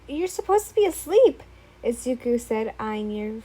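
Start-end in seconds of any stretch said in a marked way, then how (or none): 0.1-1.4 higher, louder surprised outburst; startled cry. Acted. Stalker boyfriend appears at night.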